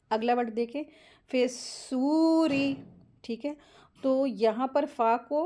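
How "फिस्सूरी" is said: फिस्सूरी with held s and long oo; joined and stretched. Shown as a demonstration. Teacher models joining letters, held s and long oo in फिस्सूरी. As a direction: join into the heavy s of फिस्सूरी, stretch oo. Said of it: "स्वाद में मिला के पढ़ेंगे".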